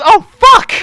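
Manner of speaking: high, loud panicked cry; panicked outburst. Retold or imitated. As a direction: blurt it out in sudden panic. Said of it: "I was freaking the fuck out".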